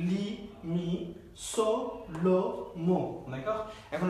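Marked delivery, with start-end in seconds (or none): 0.1-1.1 লি and মি drawn out very slowly, each syllable held; slow pronunciation drill. Shown as a demonstration. Teacher modelling the syllables for online students, very slowly. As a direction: stretch লি, মি slowly so learners can copy. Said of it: "তারপর এটা হচ্ছে সি".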